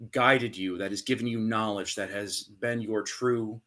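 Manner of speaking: ordinary talk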